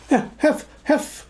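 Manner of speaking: high, gasped broken syllables; breathless. A narrator's reading. Piglet reaches Christopher Robin after running, breathless stammer. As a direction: puff out the word, unable to finish it. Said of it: "said Piglet breathlessly".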